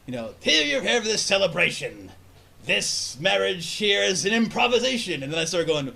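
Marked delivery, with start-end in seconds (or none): 0.4-5.2 slow, theatrical pirate officiant; improvised rhyming ceremony. Retold or imitated. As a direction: declaim the rhyme grandly, drawing it out. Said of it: "I rhyme the whole fucking thing".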